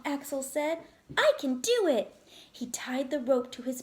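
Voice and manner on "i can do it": higher, louder insistence; determined. Acted. Axel to his dad, determined and higher. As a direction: insist against your father.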